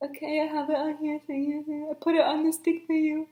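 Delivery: high, awkward little voice; painfully awkward. Retold or imitated. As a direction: re-enact your nervous fumbling politeness. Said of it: "it's just so painful".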